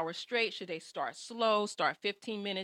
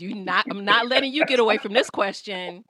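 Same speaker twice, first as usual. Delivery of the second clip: loud playful push, louder at the end; loud teasing; 10 dB louder than usual. Real, not acted. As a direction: call him out loudly, teasing. Host teasing muted guest loudly.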